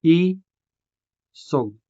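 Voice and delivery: isog drawn out slowly, i apart from sog; syllabicated isog. Shown as a demonstration. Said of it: "So to syllabicate this word".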